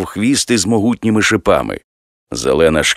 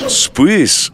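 a startled, slightly higher yelp; surprised cry; 9 semitones higher than usual. Acted. Narrator cries out in surprise at the thrown spear, higher. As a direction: blurt it out in shock. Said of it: "здивовано скрикнув я".

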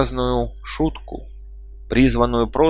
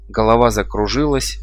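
usual speaking voice

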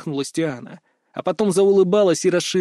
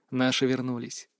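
low, soft murmur; hushed whisper; 6 semitones lower, 9 dB quieter than usual. A narrator's reading. Whispering to the portrait, hushed and secret. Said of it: "и шепнул".

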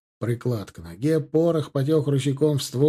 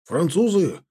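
raised lookout's call, climbing higher; warning cry; 5 semitones higher than usual. A narrator's reading. Lookout on the second floor, warning cry. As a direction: shout the sighting down. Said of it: "донёсся крик со второго этажа".

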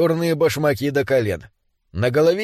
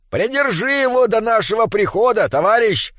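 high-pitched dwarf calling over; calling back; 8 semitones higher than usual. Acted. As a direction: call across to a comrade. Distant dwarf calls back to comrade. (окликает товарища высоким голосом)

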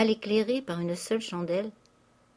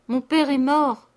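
loud, slow, higher cry of grief; anguished cry; 6 semitones higher, 9 dB louder, slower than usual. A narrator's reading. Countess crying out her father is dead, loud slow wail. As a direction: wail it out as the truth hits. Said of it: "cria la comtesse".

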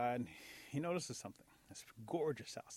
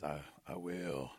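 low, slow, halting reply; deep slow frog imitation; wolf mimicking bullfrog; 9 semitones lower, slower than usual. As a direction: drop deep and drag it out like a bullfrog. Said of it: "He's mimicking the bullfrog".

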